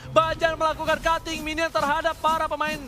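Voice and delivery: loud excited play-by-play; esports caster. Real, not acted. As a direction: keep the hype up on the call. (komentator esports bersemangat)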